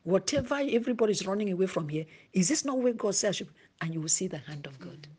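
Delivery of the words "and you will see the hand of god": soft, lower whisper. Real, not acted. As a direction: whisper the promise. Whispered conclusion.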